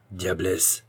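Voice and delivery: mild low growl. A narrator's reading. Nestor growling at the cat, mildly. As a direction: growl the insult under the breath. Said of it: "grogna Nestor".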